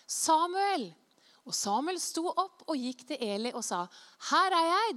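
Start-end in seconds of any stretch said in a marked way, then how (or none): 0.1-0.9 high, loud call of a name; raised, ringing summons. Acted. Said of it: "Herren ropte enda en gang". 4.3-4.9 loud, high reply; acted, raised-voice reporting in; eager answering call. Samuel answers the call again.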